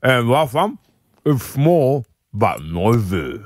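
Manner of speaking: talking round a mouthful, slow; mouth full. Real, not acted. [name] giving the last clue with his mouth full. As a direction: mumble through a full mouth. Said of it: "mouth full, giving a clue".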